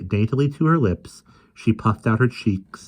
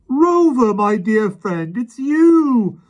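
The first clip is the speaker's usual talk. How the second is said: high, loud overjoyed cry; joyful reunion cry; 9 dB louder, much slower than usual. A narrator's reading. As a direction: cry out in joy at finding him.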